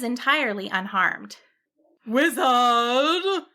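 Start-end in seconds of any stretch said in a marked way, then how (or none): 2.0-3.5 a calling yell, a bit louder; shout. A narrator's reading. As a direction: dragon calls up to the tower. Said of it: "the dragon shouted".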